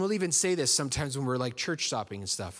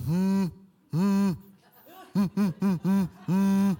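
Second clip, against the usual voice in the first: stressed groans; 6 semitones higher, 6 dB louder, much slower than usual. Retold or imitated. Dreading the phone call.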